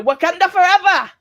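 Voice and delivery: high, loud mock war cry; film battle cry. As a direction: belt it out like a hero's rallying call. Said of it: "And then you're there going".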